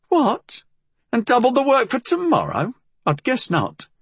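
high-pitched, incredulous housekeeper; indignant housekeeper. A narrator's reading. Housekeeper refuses a morning off, high and indignant. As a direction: balk at the idea in a high character voice. Housekeeper balks at employer's offer.

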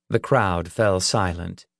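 ordinary delivery

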